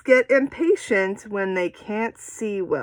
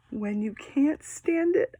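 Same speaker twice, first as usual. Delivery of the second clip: suspenseful gasp; 7 semitones higher, 4 dB quieter than usual. Shown as a demonstration. Acts unbearable suspense.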